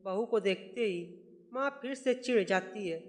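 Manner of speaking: ordinary delivery, nothing marked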